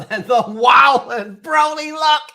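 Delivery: high loud excited shout. Real, not acted. Streamer hyped about a meme, shouting. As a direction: let it burst out with glee.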